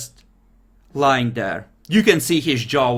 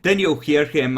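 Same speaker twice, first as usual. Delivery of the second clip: ordinary delivery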